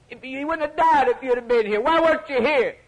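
a grieving sister's lament, retold by a preacher; crying plea. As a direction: plead through mock tears with the Lord. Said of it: "And then the other one".

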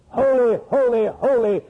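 high, loud proclamation; crying out in awe. Acted. Preacher echoing the seraphim's praise, crying out in awe. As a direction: proclaim each word as a worshipping cry. Repeats the seraphim's cry of holy.

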